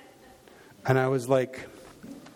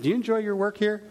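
pointed question; 7 semitones higher than usual. Retold or imitated. Pastor asking the barista.

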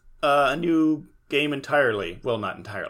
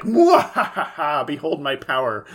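mad scientist; 4 dB louder than usual. Retold or imitated. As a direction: cackle then proclaim grandly. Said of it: "antagonist, who's like".

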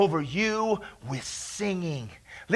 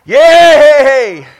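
loud, drawn-out cheer; exultant cheer; 7 semitones higher, 24 dB louder than usual. Real, not acted. Preacher celebrating infusion of strength, loud cheer. Cheering amid holy laughter.

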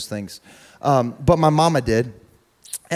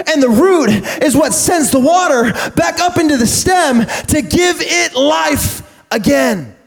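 preaching at full force; 12 semitones higher, 4 dB louder than usual. Acted. Preacher drives the lesson home to the church, full force. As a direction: whoop it to the congregation.

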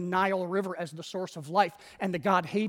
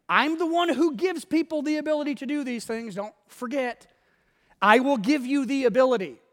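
raised, insistent voice of God; emphatic assurance; 5 semitones higher, 3 dB louder than usual. Acted. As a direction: firmly correct a doubting servant. God answers Moses's objections emphatically.

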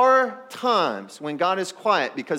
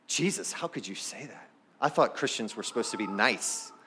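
mock offended; 7 semitones lower, 7 dB quieter than usual.

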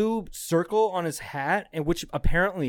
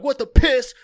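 much higher and louder, barked out; mock drill instructor yell; 11 semitones higher, 11 dB louder than usual. Drill sergeant screaming at recruits, loud mock yell. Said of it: "they're like".

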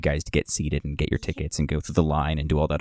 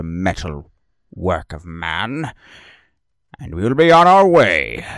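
menacing lizard shaman; 3 semitones higher, 4 dB louder than usual. Acted. Lizard shaman warning the passengers, menacing. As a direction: threaten the party from the train.

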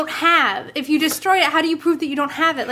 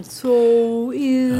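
slow motion; 3 semitones lower than usual. Pretend. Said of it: "we have to talk in slow motion".

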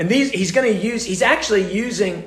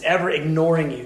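normal talking voice

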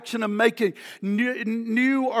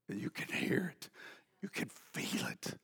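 breathy whisper, barely there; 11 semitones lower, 19 dB quieter than usual. Acted. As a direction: lean in and whisper it like a secret. Hushed preacher evoking the wail.

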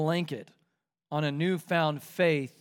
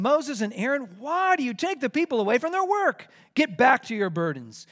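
higher, scolding king; angry Pharaoh; 6 semitones higher, 3 dB louder than usual. Acted. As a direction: rebuke them sharply. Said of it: "the king of Egypt said to them".

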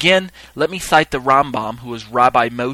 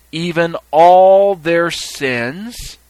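"even all their sins" slow, loud and high; emphatic reading; 5 semitones higher, 7 dB louder, much slower than usual. Shown as a demonstration. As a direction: draw out a big, loud "all" before "sins". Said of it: "I'll do the same thing".

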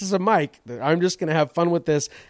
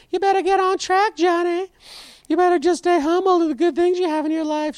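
much higher voice, a mock rock-star lecture; 12 semitones higher than usual. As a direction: talk down to yourself like your toy idol. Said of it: "He yells to his [name] figure".

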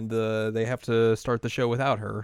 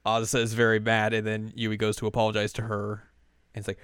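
ordinary delivery

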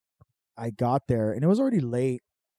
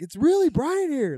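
much higher, loud yell stressing really; stoned yelling; 8 dB louder than usual. Retold or imitated. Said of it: "I was yelling that shit".

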